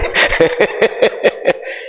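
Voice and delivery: real chuckle at his own joke; amused chuckle. Real, not acted. Preacher joking with wives about nagging husbands, amused chuckle. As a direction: let out a short run of chuckles.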